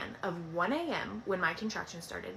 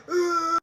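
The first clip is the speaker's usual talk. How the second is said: loud, high mock labour groan; pained groan; 9 semitones higher, 7 dB louder than usual. Mother in labour on the bed, groaning loudly. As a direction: groan out loud, imitating contraction pain.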